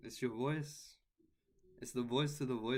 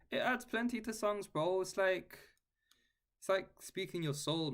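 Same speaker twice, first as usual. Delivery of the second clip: higher and louder, fired up; eager defence of guitar solos; excited; 8 semitones higher, 4 dB louder than usual. Real, not acted.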